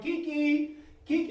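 high, loud imitated dog call; hollering. Owner driving down streets calling his dog, mock hollering. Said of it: "and I'm hollering".

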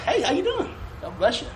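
bright high hello with a laugh; cheerful smiling greeting. Shown as a demonstration. Showing young people how a doorman greets, beaming. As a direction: greet them with a big smile. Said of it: "Smile. Like".

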